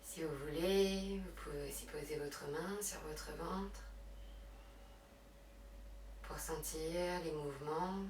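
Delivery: hushed, unhurried meditation guidance; guided relaxation. Real, not acted. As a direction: guide them gently into breath and rest. Leads yoga relaxation and breathing.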